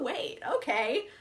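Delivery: usual speaking voice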